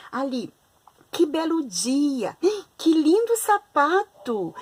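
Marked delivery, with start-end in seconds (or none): 1.1-4.5 slow, high delighted exclaiming; admiring exclamation. Shown as a demonstration. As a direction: marvel at each word slowly. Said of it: "quando a gente está admirando, está ali com alegria". (tom de admiração e alegria)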